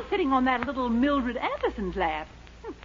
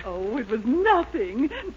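ordinary talk, nothing marked

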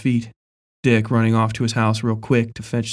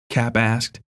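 usual speaking voice

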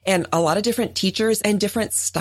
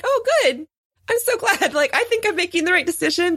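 much higher, bright gushing relief; delighted gush; 11 semitones higher than usual. Retold or imitated. Retelling own delighted reply.

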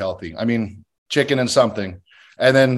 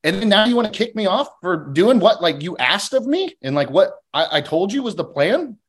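higher, heated re-enacted complaint; re-enacted anger at producers who approved his plan, higher; retold angry rant; 7 semitones higher than usual. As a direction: throw it back at them like he did then.

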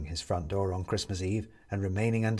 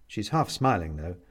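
usual speaking voice

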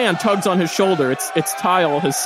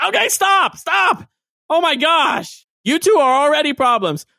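put-on much higher, flustered young assistant; Tile scolding; 7 semitones higher than usual. Tile stopping the two troublemakers, high and flustered. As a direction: flap at them like a frazzled kid.

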